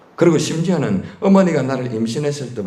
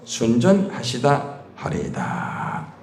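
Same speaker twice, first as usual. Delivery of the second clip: scripture reading; 2 semitones higher, slower than usual. Acted. Ends the Psalm reading.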